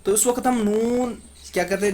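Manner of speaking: normal talking voice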